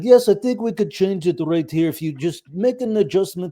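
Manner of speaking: Russian Bond villain discussing blueprints; Vladimir's villain accent. Retold or imitated. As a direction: mimic a Russian-accented engineer on the phone. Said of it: "reminded me of good old Vladimir".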